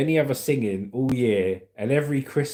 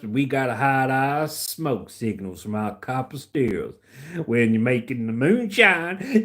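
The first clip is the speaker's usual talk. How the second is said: put-on hillbilly accent. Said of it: "Can you do a country or hillbilly accent?".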